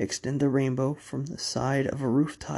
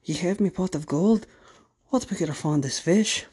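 high, loud Irish leprechaun voice; 4 semitones higher, 5 dB louder than usual. Acted. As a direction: belt it out as a grumbling leprechaun. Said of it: "the leprechaun is mighty glum".

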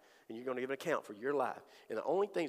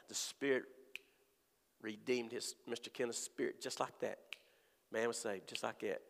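usual speaking voice

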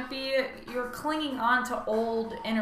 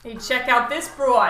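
put-on New York accent; 8 dB louder than usual. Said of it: "tease about the New York accent".

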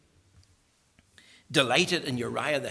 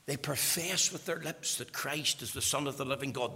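usual speaking voice

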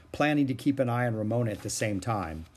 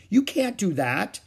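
higher, slow horrified protest; 9 semitones higher, 4 dB louder than usual. A narrator's reading. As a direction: say it aghast, slowly.

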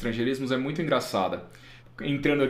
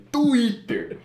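Twitter high-pitched with a heavy stress on the I; audible accent mark; 8 semitones higher, 4 dB louder than usual. Shown as a demonstration. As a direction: punch the middle of Twitter, "tu-Í-ter". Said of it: "Você ouve o acento ali".

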